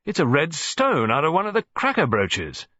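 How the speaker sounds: the colonel holding it up; old colonel voice. A narrator's reading. Colonel examines the stone.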